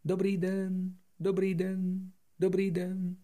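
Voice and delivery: high repeated echo. Acted. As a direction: sound like a distant mountain echo. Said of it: "opakovala ozvěna". (ozvěna z hor)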